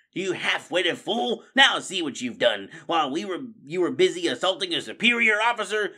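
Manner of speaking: loud, high-pitched scolding; angry berating. A narrator's reading. Officer rat blames his crony, berating him. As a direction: tear into a useless subordinate. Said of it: "Redtooth berated Fangburn".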